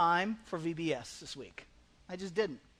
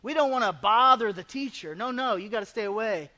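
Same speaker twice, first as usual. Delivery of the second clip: loud, higher, scolding shoo; shooing disciples; 6 semitones higher, 8 dB louder than usual. Acted. Disciples shooing away children, scolding loudly. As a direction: shoo the kids away, annoyed. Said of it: "the disciples are like".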